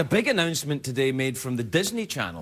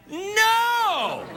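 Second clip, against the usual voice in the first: high shouted no; mock outrage. Host answers his own question, hammed-up shout. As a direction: bark a hammed-up no at the audience.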